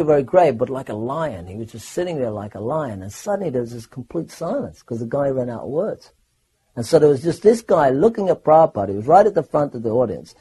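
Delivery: ordinary delivery, nothing marked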